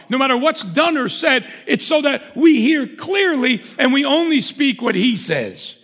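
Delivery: loud, high-pitched impassioned sermon; full-force preaching. Real, not acted.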